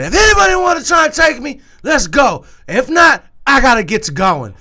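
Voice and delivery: high, loud challenge to the room; imitating a friend yelling a dare; retold yell. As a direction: shout it like a bar brawler daring takers. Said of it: "and I yelled it".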